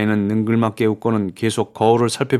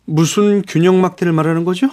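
high-pitched puzzled question; 8 semitones higher, 5 dB louder than usual. A narrator's reading. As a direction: ask sharply, not understanding. Puzzled Raoul questions the explanation.

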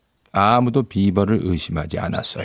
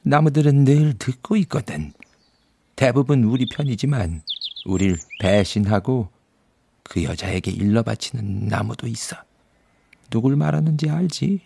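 beaver; 6 semitones higher than usual. Acted. Narrator voices the beaver.

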